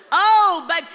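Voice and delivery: high, loud Oh babe; affectionate coo. Retold or imitated. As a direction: gush over the child lovingly. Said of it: "she was lots of like".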